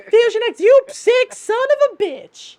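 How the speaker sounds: very high, loud put-on woman's voice; furious chick voice. Knife wife cursing Fusion X, furious high female voice. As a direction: curse him out way up high, stretching the insult. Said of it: "should I sound like this?".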